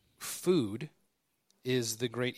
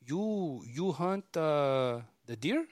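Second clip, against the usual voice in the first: a higher, halting imitation of a Mexican worker's accent; a shy worker in a light Mexican accent asking the hunter a question; 5 semitones higher, much slower than usual. Workers asking the homeowner about hunting deer, lightly imitated Mexican accent. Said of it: "It was four Mexican dudes".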